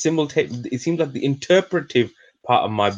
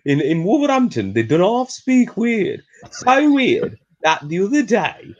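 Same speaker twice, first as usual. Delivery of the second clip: incredulous; 12 semitones higher than usual. Real, not acted. Mock outrage at local accent.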